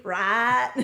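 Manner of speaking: "Right" with a drawn Southern "ah" vowel; "Right" with the long I broadened and flattened to "ah"; thicker accented I. Shown as a demonstration. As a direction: say "Right" as "raht", country-style. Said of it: "I'll do the eyes more".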